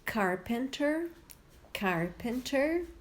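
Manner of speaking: "carpenter" slow and high, rising; model word twice. Shown as a demonstration. Teacher modelling a word to listen and repeat. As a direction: say "carpenter" slowly for them to repeat. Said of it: "Ouve e repete".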